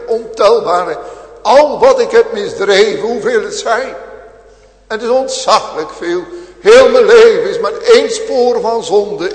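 full-force sermon voice; fiery preaching. Acted. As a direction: thunder it at the congregation.

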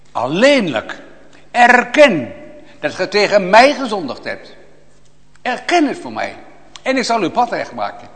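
high, loud commanding plea; loud voice of God. Acted. Preacher voicing God's call to confess, loud and high. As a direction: thunder it out as God's own command. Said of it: "Hij zegt".

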